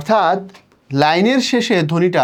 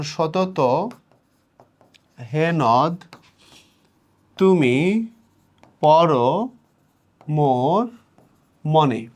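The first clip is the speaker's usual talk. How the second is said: সতত হে নদ তুমি পর মোর মনে with a long pause between words; word-by-word verse reading; much slower than usual. Shown as a demonstration. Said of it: "তুমি যখন কবিতা পড়বা". (সতত হে নদ তুমি পর মোর মনে থেমে থেমে পড়া)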